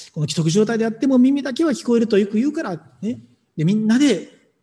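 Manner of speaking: loud, high, impassioned preaching; full-force preaching. Acted. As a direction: drive it home to the congregation. (力強く熱のこもった説教)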